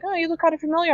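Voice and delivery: a hint of a dazed Darkwing squinting at them; Darkwing puzzled. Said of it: "even Darkwing is like".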